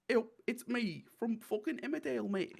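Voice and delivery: high-pitched caricature Yorkshire; Emmerdale Yorkshire accent. Retold or imitated. Riffing on classic Emmerdale, mock Yorkshire accent. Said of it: "classic Emmerdale".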